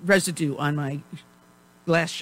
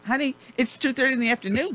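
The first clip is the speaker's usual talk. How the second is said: quick, higher teasing correction; 6 semitones higher, faster than usual. Real, not acted. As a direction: tease him about the time.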